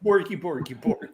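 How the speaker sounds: a hint of Scandinavian babble; playful fake Scandinavian chant; mock Scandinavian gibberish. Joining the joke, mock Scandinavian babble. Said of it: "old country Scandinavian accent".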